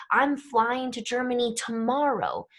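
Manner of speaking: emphasis landing on "tomorrow"; stress on tomorrow. Shown as a demonstration. Teacher showing English emphasis by voice, stress on "tomorrow". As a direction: lean on "tomorrow" to single it out. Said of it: "with our intonation to say".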